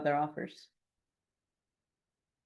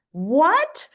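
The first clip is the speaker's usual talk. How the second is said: higher, louder shocked cry; shocked what; 10 semitones higher, 9 dB louder than usual. Retold or imitated. Agent hearing another offer was accepted, mock shock. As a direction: act stunned by the bad news.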